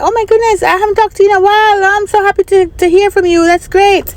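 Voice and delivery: high, fast happy gush; gushing with joy at a call from an old friend; excited. Retold or imitated. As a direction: burst out delighted. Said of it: "I was excited".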